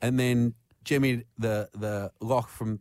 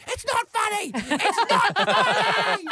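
much higher, slow, laughing protest; upset protest; slower than usual. Retold or imitated. Imitating the trapped woman protesting at the laughter. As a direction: wail it between laughs. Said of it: "the other voice going".

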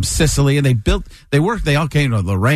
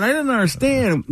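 usual speaking voice

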